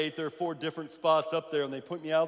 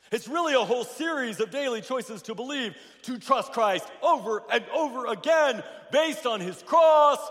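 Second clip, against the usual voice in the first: higher and stronger, hammering the point; emphatic preaching; 6 semitones higher, 6 dB louder than usual. Acted. Pastor repeating the key line to his congregation, rising emphatic preaching. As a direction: repeat it with pulpit force.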